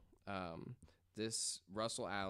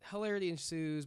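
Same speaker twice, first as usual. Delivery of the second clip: ordinary talk, nothing marked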